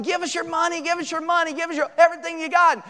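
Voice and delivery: high-pitched retold robber's demand; mock yelling. Robbers demanding money from the family, mock yelling. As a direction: imitate an urgent yell without full volume. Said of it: "they started yelling".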